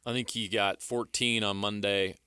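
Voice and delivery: normal talking voice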